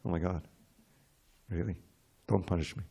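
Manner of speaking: awed reverence. Retold or imitated. A devotee pleads softly with God, hushed in awe. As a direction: shrink back in mock reverent fear. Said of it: "all, all in reverence".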